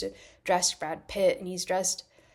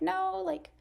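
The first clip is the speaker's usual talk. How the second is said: mock snooty stylist; 7 semitones higher than usual. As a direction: lay down impossible rules like a precious stylist. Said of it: "a lot of stylists are kinda like".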